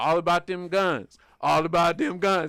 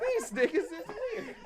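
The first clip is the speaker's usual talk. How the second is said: high, slow, softer through laughter; laughing; 11 semitones higher, 8 dB quieter than usual. Real, not acted. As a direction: giggle the words out. Echoes the line laughing.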